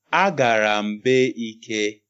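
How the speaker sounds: slow, word by word, separated; over enunciating. Shown as a demonstration. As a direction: say each word apart, slowly. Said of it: "let me say it slowly".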